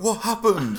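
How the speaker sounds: high, loud startled squeal as the Queen. Retold or imitated. Queen waking up to find him gone, mock squeal. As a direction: shriek it like a just-woken Queen.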